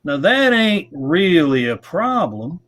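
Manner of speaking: slow, high, stretched-out Savannah drawl; imitating a friend's Savannah drawl, high and slow. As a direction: lay on a lazy Savannah drawl, unhurried and easygoing. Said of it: "in his Savannah drawl".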